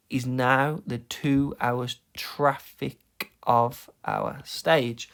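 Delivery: "is NOW the TWO hours", slow separated beats; stress on focal words. Shown as a demonstration. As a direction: slowly punch every second syllable through "our STAGE". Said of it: "the focal words are the ones that have".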